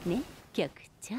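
soft unvoiced whisper. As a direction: lean in and breathe it to him. Flirty aside to the chief. (囁き声で)

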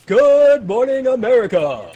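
loud, slow morning-show host; TV host announcer. Pretend. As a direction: boom it like a TV anchor. Mock TV host greeting.

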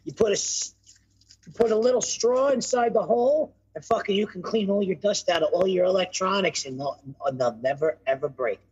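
usual speaking voice

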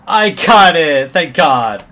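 very loud, slow, relieved cry; triumphant shout. Real, not acted. Made the time just barely.

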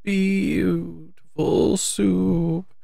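very slow, high, sobbing song; mournful ending sung very slowly and high; drawn-out sob song. A narrator's reading. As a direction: stretch each word out tearfully. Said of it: "a voice sometimes choked with sobs".

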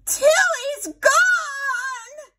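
very high, drawn-out shriek; aunt screaming that her parrot is gone. A narrator's reading. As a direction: wail it out in panic. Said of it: "she ran out screaming".

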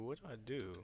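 ordinary talk

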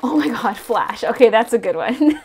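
loud delighted reveal; excited. Real, not acted. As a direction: exclaim in delight. Reveals figure.

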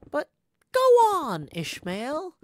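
loud, high burst on "go on"; urging himself on. Acted. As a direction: call out to yourself, pitch leaping up. Narrator scolds himself aloud.

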